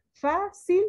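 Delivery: "Fácil" split, FA pitched high; stress on first syllable. Shown as a demonstration. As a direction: punch a high FA in "Fácil", then cil apart. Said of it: "sílaba tónica fa".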